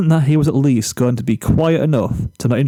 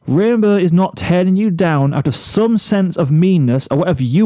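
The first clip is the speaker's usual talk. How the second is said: angry Flash; 6 semitones higher than usual. Acted. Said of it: "a more angry look than before".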